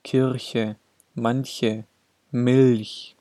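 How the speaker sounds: soft front ch after the consonant in "Kirche", "manche", "Milch". Shown as a demonstration. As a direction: say "Milch" with the soft palatal ch of ich. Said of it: "Nach allen Konsonanten".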